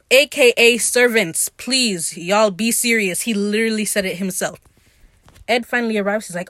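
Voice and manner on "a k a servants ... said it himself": loud, emphatic real exasperation; exasperated insistence. Real, not acted. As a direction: hammer the point home, louder. Exasperated plea to the viewers.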